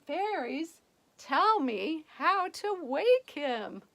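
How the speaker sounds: calling out. Acted. Beauty calling fairies.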